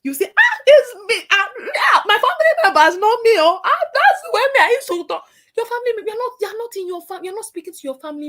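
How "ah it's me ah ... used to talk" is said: high, loud, laughing self-defence; mock loud excuse. Mocking someone defending their loud way of talking, high and loud. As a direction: brush off the correction loudly. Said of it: "they're trying to correct you".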